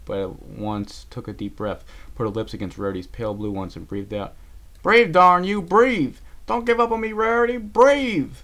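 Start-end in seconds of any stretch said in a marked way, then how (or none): 4.8-8.4 high, loud, desperate command; desperate pleading. A narrator's reading. Applejack reviving her friend who isn't breathing, shouting desperately. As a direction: shout it to bring someone back.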